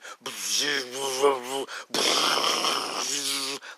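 buzzing, crackling mouth noise; electricity noise. Retold or imitated. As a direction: imitate a crackling cloud of electricity. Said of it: "like electricity".

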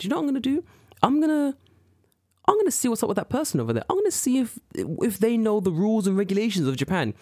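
high-pitched, self-important resolve; high mocking voice; mock busybody.

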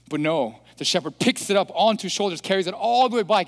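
ordinary delivery

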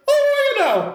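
extremely high mock-Irish lilt; high-pitched Irish inflection. Mimicking the Irishman's inflection, high-pitched cartoon Irish accent. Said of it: "everything just sounded like that inflection".

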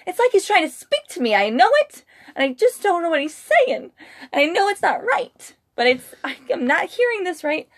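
high, rising bewilderment; baffled inner voice. Retold or imitated. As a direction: retell your head spinning in confusion. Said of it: "in your head going".

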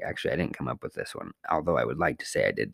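quick, soft confessional whisper; whispered aside. Real, not acted. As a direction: drop to a hushed aside.

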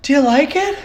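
high mock voice asking about a gift; hammed-up eager giver fishing for thanks; lightly voiced eager gift-giver; imitating stepmom's partner. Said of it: "he's like".

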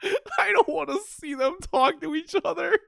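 fake-cry it through a laugh; high, wailing pretend despair; mock crying whine.

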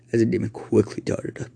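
ordinary delivery

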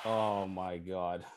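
soft voice fighting tears; holding back tears. Real, not acted. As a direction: keep it together as tears come. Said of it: "I'm trying not to cry in front of you guys".

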